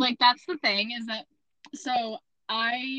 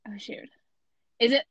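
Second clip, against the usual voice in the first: ordinary delivery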